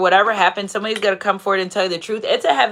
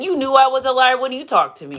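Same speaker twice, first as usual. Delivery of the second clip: usual speaking voice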